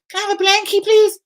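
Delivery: put-on very high babyish whine; squeaky childish plea for a blankie; whiny blankie voice.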